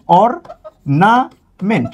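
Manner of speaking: অর্নামেন্ট spelled out slowly, vowel-first; slow demo of ornament. Shown as a demonstration. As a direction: stretch অর্নামেন্ট out syllable by syllable. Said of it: "এই যে দেখেন".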